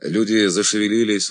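plain reading voice

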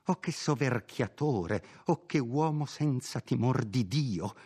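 a shocked housekeeper who catches herself and pities him; Perpetua, the housekeeper, scandalised then sorry for her master; indignant housekeeper. Acted. Perpetua outraged then hushed.

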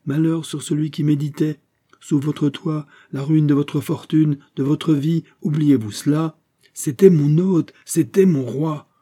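high, ringing indignation; impassioned outburst. Acted. Heated exchange over the wronged king, impassioned. Fouquet's impassioned quarrel with Aramis.

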